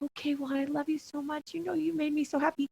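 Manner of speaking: hushed and tender, nearly murmured; soft loving words. Pretend. Woman imagining tender words to her husband, hushed and soft. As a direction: say it gently, as if only for him.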